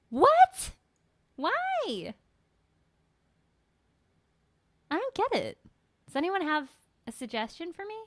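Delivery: thin tinny mic sound. Real, not acted. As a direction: voice coming through a can. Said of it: "You sound like you're in a can".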